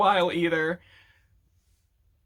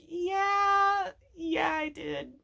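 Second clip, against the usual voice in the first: Bill admits it; 10 semitones higher, much slower than usual. Retold or imitated. Bill admits guilt to Ford.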